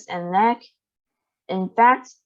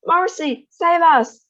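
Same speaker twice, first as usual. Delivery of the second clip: high loud yell; 8 semitones higher, 6 dB louder, slower than usual. A narrator's reading. Mother yells for mercy, high and loud. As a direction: yell out in panic. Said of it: "yelled Missus Beauregard".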